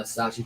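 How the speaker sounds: "Sashi" with a sh in place of Shotzi's ts; mispronounced name. Real, not acted. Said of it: "You said Sasha".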